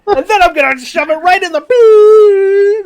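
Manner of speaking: very high, slow, blaring mock rage; hammed-up gamer meltdown; screaming profanity. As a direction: scream the threat and hold the final word. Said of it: "then I scream profanities at my computer".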